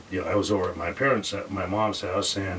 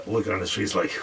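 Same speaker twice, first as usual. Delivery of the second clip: ordinary delivery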